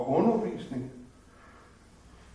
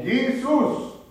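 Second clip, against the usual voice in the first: high, urgent shout for help; calling out; 12 semitones higher, 7 dB louder than usual. Retold or imitated. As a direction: cry out to Jesus, high and loud. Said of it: "Så skynder jeg mig at råbe".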